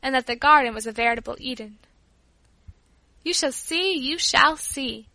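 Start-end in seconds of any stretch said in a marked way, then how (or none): 3.2-3.9 quick, bright promise with a rising end; a child insisting on the wonders of her grandfather's house; eager exclaim. Acted. Child bragging to her cousin, eagerly. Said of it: "I exclaimed". 4.0-5.1 stretched out slowly, leaning on the words; slow emphatic repeat. Acted. Child repeating her promise to her cousin, slowly and firmly. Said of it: "I exclaimed".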